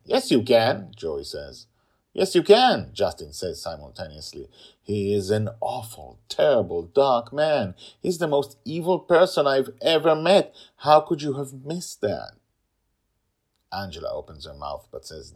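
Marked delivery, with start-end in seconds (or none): 0.0-0.9 insisting. Acted. Child insisting. 2.1-2.9 loud, high firm insistence; insisting. Acted. Father overruling his sister, loud insistence. 4.8-12.4 loud, higher angry condemnation; condemning. Acted. Brother condemning sister's husband, loud angry.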